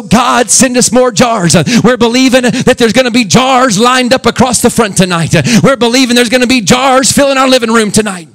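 hammed-up prayer in a preacher's voice, high and loud; the pastor mocking showy prayer in a preacher's voice. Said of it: "when you're praying in a preacher's voice".

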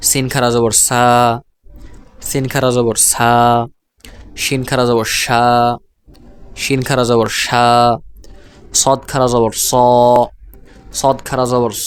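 closing সা and স each held long; measured spelling, then stretch the final সা or স; long madd vowel. Shown as a demonstration. Teacher models khara zabar, final সা, স drawn out. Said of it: "জবরের উচ্চারণ এভাবে".

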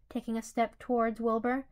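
usual speaking voice

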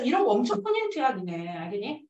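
usual speaking voice

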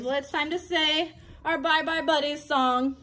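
usual speaking voice

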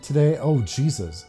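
ordinary talk, nothing marked

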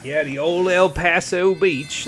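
put-on terrible southern accent. Player jokingly mangles a beach's name, terrible southern accent. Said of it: "That was a terrible southern accent".

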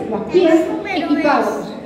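ordinary talk, nothing marked

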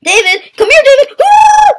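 high holler rising to a whoop; calling out. Real, not acted. Player chasing his friend David in game, excited calling out. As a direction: call high, climbing into a loud whoop.

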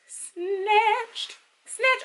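high-pitched, drawn-out squeal of approval; playful hype exclamation. Pretend. Hyping how the waist fits.

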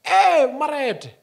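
high, loud rude hail; rude call. Retold or imitated. The woman hailing an old man, loudly rude. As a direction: call him out rudely.

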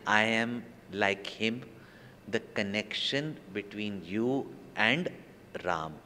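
slow, soft, measured answer; a hint of a calm master; slow gentle reply. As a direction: say it slowly and softly, like a calm master. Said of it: "And says".

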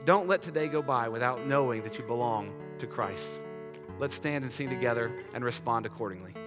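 ordinary talk

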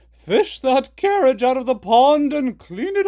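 very loud stern mother; commanding. Acted. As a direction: boom down at the boys. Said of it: "she told them".

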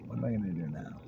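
soft, musing question to yourself; quiet self-talk. Retold or imitated. Man wondering to himself what comes next, quietly. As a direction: drop to a soft, settled musing. Same question repeated, settled.

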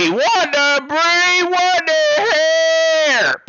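loud, very high, drawn-out yell; stunned shriek. Real, not acted. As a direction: scream the name in disbelief, stretching the last word. Stunned by another donor's gift.

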